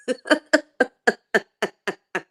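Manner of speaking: tipsy high-pitched giggle. Real, not acted. Tipsy laughing at herself.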